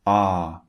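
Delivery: Ah held long, mouth in the middle; long ah demo. Shown as a demonstration. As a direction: model the vowel Ah. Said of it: "They are relaxed".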